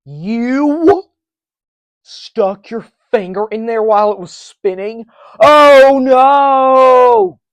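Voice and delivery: high, loud scolding yell; mock yell. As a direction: shriek it like a scandalised viewer. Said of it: "anyone to yell at me and go".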